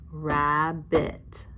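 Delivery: Rabbit tapped out as "Rab - bit", evenly spaced; split into syllables. Shown as a demonstration. Teacher tapping the word with pupils, split into syllables. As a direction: break Rabbit into two distinct beats. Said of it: "Now let's tap it".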